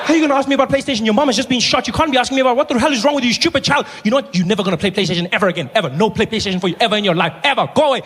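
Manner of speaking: high-pitched exasperated rant; hammed-up outraged telling-off, words tumbling out; angry scolding. Big brother banning PlayStation, high mock-furious scolding.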